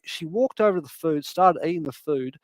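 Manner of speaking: ordinary delivery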